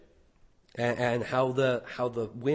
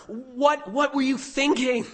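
higher, alarmed what were you thinking; alarmed question; 9 semitones higher than usual. Acted. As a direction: ask it in alarm, pitched up. Sailors' horrified outburst.

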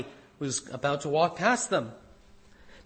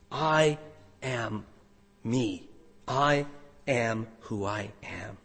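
"I" and "am" set apart by pauses, each softer and lower; slow weighted words; 6 semitones lower, 4 dB quieter, slower than usual. Shown as a demonstration. Preacher unfolding the divine name, slow and hushed. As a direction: lay out I ... am ... who I am slowly. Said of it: "Ego eimi".

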